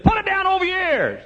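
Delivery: very high, loud mama voice; hollering mother. Retold or imitated. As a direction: holler orders at your son like a fussing mother. And she said.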